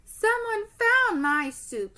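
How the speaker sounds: high, slow squeaky cub voice; high squeaky baby bear. Acted. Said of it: "in her high, squeaky voice".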